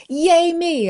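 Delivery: high, loud, drawn-out little cheer; celebratory cheer. Acted. Cheering yourself for a small win, celebratory.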